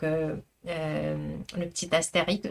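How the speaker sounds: normal talking voice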